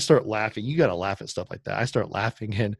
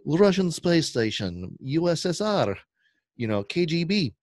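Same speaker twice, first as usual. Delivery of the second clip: high-pitched cartoon Russian accent, slow to start; mock Russian accent; 7 semitones higher, slower than usual. Storyteller caricatures the mover as some Russian dude, mock Russian accent. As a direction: put on a silly, higher Russian voice. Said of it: "some Russian dude".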